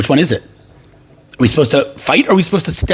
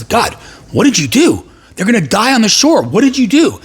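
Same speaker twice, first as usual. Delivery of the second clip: higher-pitched exasperated appeal; acted protest; 6 semitones higher than usual. Moses turns to God at the shore, acted exasperation. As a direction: demand an answer in mock distress. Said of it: "Moses turns to God and says".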